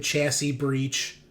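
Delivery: ordinary talk, nothing marked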